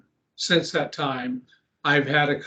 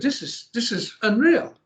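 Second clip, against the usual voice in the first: higher-pitched retold astonishment; amazed; 6 semitones higher than usual. Wife reacts to the vanished cuts, amazed. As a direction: imitate a wife in disbelief, pitch lifted.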